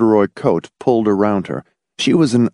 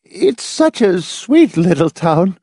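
high, slow, put-on wavering voice; wavering, uncertain voice; 9 semitones higher than usual. A narrator's reading. Edith Pritchett admires the town, wavering and uncertain. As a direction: dither slowly in a high voice. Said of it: "in a wavering, uncertain voice".

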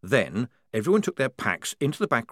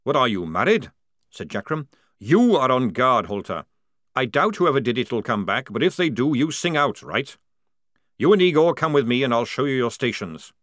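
loud barking drill sergeant; booming sergeant; 3 semitones higher, 5 dB louder than usual. Acted. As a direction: call the lads to order. Jackram voiced.

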